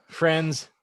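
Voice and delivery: ordinary talk, nothing marked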